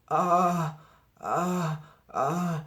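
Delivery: high, loud panicked uh uh uh; nervous stammer. A narrator's reading. Boy's mind goes blank before the president, panicked stammer. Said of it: "made Arthur's mind go blank".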